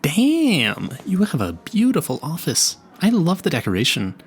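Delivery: awed by a beautiful room; gushing admiration; impressed. Acted. Impressed entering the office.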